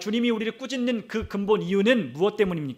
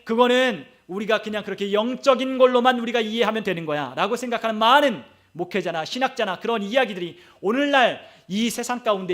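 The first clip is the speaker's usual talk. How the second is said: normal talking voice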